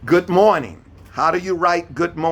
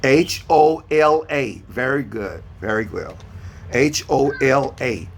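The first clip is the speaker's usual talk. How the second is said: ordinary delivery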